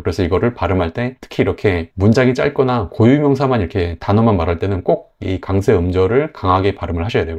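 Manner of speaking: usual speaking voice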